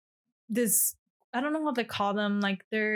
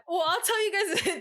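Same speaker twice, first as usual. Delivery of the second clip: laughing; 6 semitones higher than usual. Real, not acted. Laughing while starting earthquake story.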